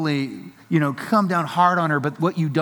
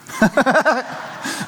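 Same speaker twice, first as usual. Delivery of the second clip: high real laugh; 9 semitones higher than usual. Real, not acted. Father laughing at his son's line, high real laugh. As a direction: burst out laughing at it.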